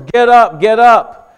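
loud, high, repeated wake-up shouts; retold yell. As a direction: act out yelling a sleeper awake. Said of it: "He yells at him".

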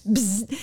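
buzzing noise like a game buzzer; buzzer imitation. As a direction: buzz through the lips. Imitates the buzzer by her ear.

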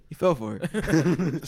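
slow, offhand, laughing through it; casual. Retold or imitated. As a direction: toss it off casually. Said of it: "He says it casually".

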